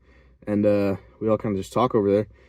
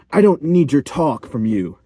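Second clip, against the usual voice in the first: louder, slower, higher mock rebuke; raised-voice, deliberate telling-off; imitated teacher snapback; 4 semitones higher, 5 dB louder than usual. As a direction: imitate an irritated teacher shutting a student down. Imitating the irritated substitute teacher.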